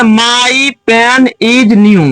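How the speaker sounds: each word read out very slowly and clearly; slow dictation. Shown as a demonstration. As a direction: spell it out slowly for the class. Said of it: "दूसरा sentence है". (बहुत धीरे, साफ़-साफ़ बोलकर)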